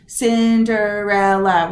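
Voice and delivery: slow "Cinderella", each syllable set apart; kindergarten-style "Cin-de-rel-la", slow and even; clapping out syllables. Shown as a demonstration. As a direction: tap out "Cinderella" syllable by syllable, slowly. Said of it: "clap them out in kindergarten".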